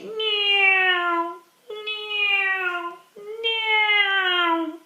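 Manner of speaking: high, long "neeow" swooping engine sound; be a child's zooming aeroplane; aeroplane noise. Shown as a demonstration. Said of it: "pretending to be an aeroplane".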